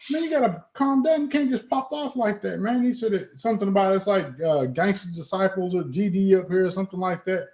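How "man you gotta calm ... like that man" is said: much higher, soothing mock voice of the local; imitating Chicago guy. Chicago stranger telling him to calm down, mock soothing. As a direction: play the guy calming him down. Same guy continues lecturing.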